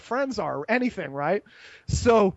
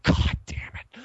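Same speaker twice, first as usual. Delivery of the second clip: breathy whispered "God damn it" under the breath; a deflated whisper after being dumped; whispered curse; slower than usual. Retold or imitated. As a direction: mutter it to yourself, defeated. Rejected, mutters to himself.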